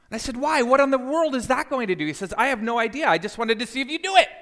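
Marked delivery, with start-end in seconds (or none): 0.4-2.1 high, loud incredulous questioning; retold baffled outburst; exasperated protest. Retold protest at odd remedy. 2.4-4.3 high, loud, quick admission; retold rapid-fire comeback; gleeful confession. Brother gleefully admits the trick.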